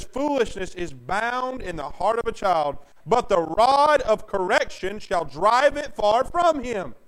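slow, loud pulpit reading that builds; preaching scripture. Acted. Preacher reading Proverbs to his church, booming. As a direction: proclaim the verse to the congregation. Reads scripture at full force.

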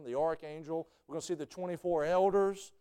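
normal talking voice

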